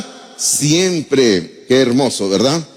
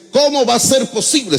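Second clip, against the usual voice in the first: mock-outraged higher question; mock indignation; 8 semitones higher than usual. Preacher mimicking indignant Christians who blame the innkeeper, mock-outraged. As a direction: sneer it like an indignant churchgoer. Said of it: "Se indignan algunos cristianos".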